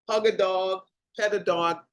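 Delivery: ordinary talk, nothing marked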